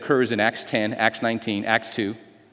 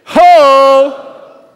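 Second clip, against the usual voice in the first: loud, high shouted call; prophet's cry; 11 semitones higher, 12 dB louder than usual. Shown as a demonstration. Preacher voicing Isaiah's call, loud shout. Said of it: "Let's all say that together".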